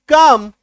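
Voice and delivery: "काम" loud, one syllable with no L; silent L. Shown as a demonstration. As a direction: say "काम" loudly, long A into M. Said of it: "काट दीजिए एल को". ("काम" में ल silent)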